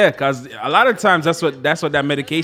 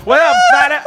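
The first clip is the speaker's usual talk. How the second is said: a much higher, louder laughing mock American "What up, Father?"; mock American accent; 11 semitones higher, 7 dB louder than usual. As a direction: ham up the Yankee greeting while laughing. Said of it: "That's what the Yankee them say".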